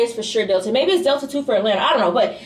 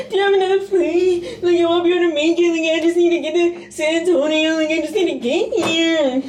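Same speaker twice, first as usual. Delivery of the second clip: frantic pleading; 6 semitones higher than usual. Retold or imitated. Pleading with the gate agent.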